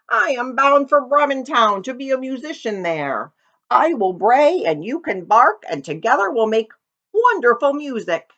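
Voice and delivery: put-on a cheerful old donkey calling out; friendly donkey. Donkey voice in the story.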